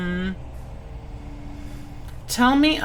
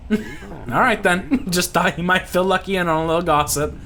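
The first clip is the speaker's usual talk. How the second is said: lightly higher Louisiana character voice; Louisiana accent; 4 semitones higher than usual. Acted. Pirate questioning the robot, Louisiana accent. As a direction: ask it as a folksy Louisiana local. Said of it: "slipping into the accent".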